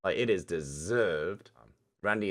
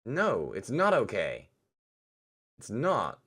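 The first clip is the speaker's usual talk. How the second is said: firm refusal; 3 semitones higher, slower than usual. Acted. Damien refuses to calm down.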